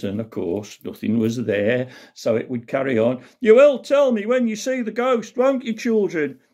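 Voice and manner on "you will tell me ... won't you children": put-on high-pitched panto host to children; panto Buttons. Buttons asking children to spot the ghost, high and theatrical. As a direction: work the audience like Buttons, pitched well up.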